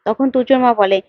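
usual speaking voice

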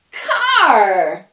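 loud punch on the last word after a pause; big reveal. Acted. The monkeys tricking the crocodiles, loud punchline. Monkeys selling crocs the car.

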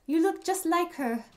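high, gushing astonishment; overjoyed character, pitch leaping up; amazed. Acted. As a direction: gasp in delighted recognition. Character amazed at meeting Claire's likeness.

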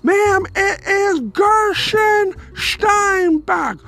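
very high, very slow, exaggerated old man repeating his name; a pleading, drawn-out announcement over and over; Gershon Steinbach's voice. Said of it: "He goes".

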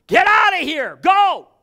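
high, loud shout; full-voiced shout; shouted command. Shown as a demonstration. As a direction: bark it at the enemy. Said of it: "You gotta do that sometimes".

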